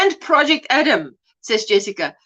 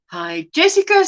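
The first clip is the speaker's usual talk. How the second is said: ordinary delivery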